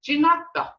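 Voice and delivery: teacher models "Ginata" clearly; Muskogee word Ginata. Shown as a demonstration. Teacher models a Muskogee word to listeners, high and clear. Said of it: "you can say".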